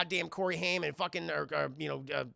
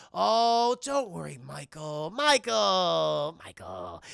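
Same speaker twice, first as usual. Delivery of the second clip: taunting reassurance; 4 dB louder, much slower than usual. Retold or imitated. Vampire leader taunts the worried newcomer, mock soothing. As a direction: toy with him, stretching the name.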